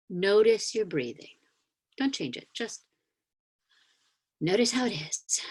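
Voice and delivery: calm meditation guide inviting the congregation to notice; guided grounding meditation. Acted. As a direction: gently lead them back into their bodies.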